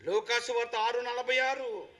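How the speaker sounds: ordinary talk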